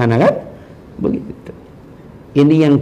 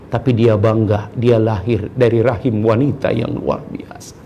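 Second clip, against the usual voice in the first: ordinary delivery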